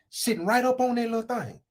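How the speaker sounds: usual speaking voice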